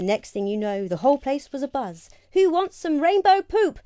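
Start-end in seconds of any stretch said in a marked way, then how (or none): 2.3-3.8 high, loud market call. Acted. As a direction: call it like a street seller. Townsfolk calling out offer.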